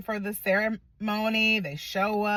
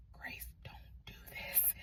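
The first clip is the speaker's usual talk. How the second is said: soft urgent whisper; hushed retold plea; 27 dB quieter than usual. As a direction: breathe it as a secret warning. Sister pleading before the wedding.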